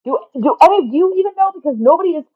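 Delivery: very high, loud, exasperated outburst; flustered exasperation. Real, not acted. Speaker asking silent listeners for help, loud and exasperated. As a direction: cry out in real disbelief at yourself.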